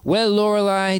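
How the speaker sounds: usual speaking voice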